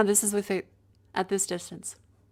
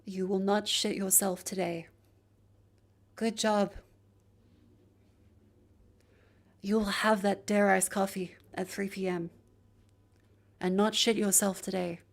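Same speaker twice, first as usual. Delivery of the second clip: breathy whisper. Pretend. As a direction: breathe the words out. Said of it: "Breathy voice affirmations".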